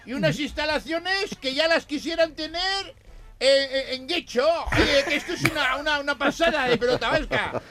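high voice from beyond the grave; ghostly voice. Acted. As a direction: keep the ghost voice going. Ghost describing heaven.

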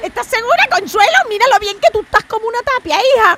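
put-on high, loud old woman voice; old lady voice. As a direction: squawk like an elderly beach lady. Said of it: "que me tengo que cambiar el personaje".

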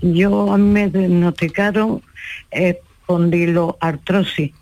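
ordinary talk, nothing marked

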